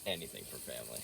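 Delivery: low gruff solemn delivery; deep movie hero line. Pretend. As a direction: say it like a tough-guy movie hero. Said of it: "Say it one more time".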